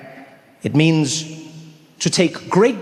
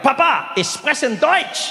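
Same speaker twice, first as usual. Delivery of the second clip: higher-pitched imagined surprise reveal in German; proud announcement; 8 semitones higher than usual. Pretend. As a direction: proudly show off your German to dad. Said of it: "Then I'd be like".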